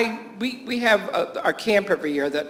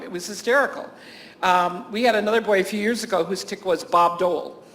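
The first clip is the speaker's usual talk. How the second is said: ordinary talk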